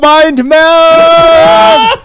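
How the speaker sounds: slow, high, loud cry of delight; excited shout. Real, not acted. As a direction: belt it out in triumph. Excited exclaim.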